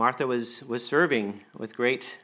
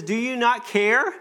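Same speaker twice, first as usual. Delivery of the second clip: high, loud and slow, pleading with hurt; a worn-out helper begging to be noticed; exasperated plea; 10 semitones higher, 6 dB louder, slower than usual. Acted. Martha pleading with the Lord, high and exasperated. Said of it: "she comes to the Lord and says".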